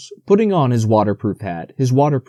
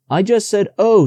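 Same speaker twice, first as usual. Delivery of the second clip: nervous; 9 semitones higher, 4 dB louder than usual. A narrator's reading. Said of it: "said Piglet nervously".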